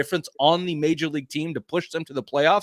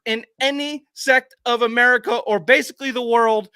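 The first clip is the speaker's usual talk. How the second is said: louder, higher heated rant; heated podcast rant about the slur; angry rant; 7 semitones higher, 7 dB louder than usual. Real, not acted. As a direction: lay into them, voice raised. Ranting at slur defenders.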